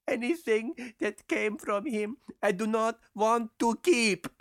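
put-on high-pitched, upset girl character; girl crying out. A narrator's reading. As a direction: cry it out high, as a girl rejecting her lover. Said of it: "She cried".

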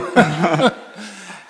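a rising, high laugh; amused chuckle; real laugh. Real, not acted. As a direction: let the laugh spill out. Laughs at the memory.

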